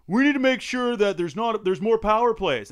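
high, loud, earnest-sounding caricature; mock eager contestant. One guy answering the hockey question, mock eager pitch. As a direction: mimic an overeager rival pitching his answer. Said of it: "One guy's like".

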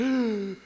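acted-out sharp intake of breath; demonstrated gasp of alarm; anxious gasp. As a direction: gasp as if struck by sudden worry.

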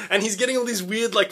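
usual speaking voice

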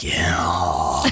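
very low, drawn-out comic assent; mock seductress. Acting her answer to his question, deep and drawn out. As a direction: sink deep into a slow come-on. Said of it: "And she's like".

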